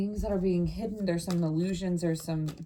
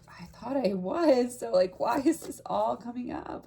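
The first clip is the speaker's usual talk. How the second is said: acted exasperation; 5 semitones higher than usual. Reader voices the client's thoughts, mock-exasperated. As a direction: imitate a troubled person questioning themselves, pitched up. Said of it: "I think you're like".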